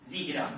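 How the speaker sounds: زہرا said softly as "Zira", i-vowel after z; quiet "Zihra" for زہرا; zer under ze. Shown as a demonstration. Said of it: "زیر ہوگا تو زہرا بنے گا".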